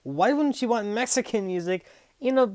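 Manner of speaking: loud, high sarcastic cheer. Acted. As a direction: oversell it sarcastically. Sarcasm about the music choice.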